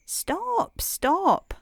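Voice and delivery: two high-pitched cries of alarm; excited cry. A narrator's reading. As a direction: cry out high, drawing each word out. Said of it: "cried the frog, hopping about excitedly".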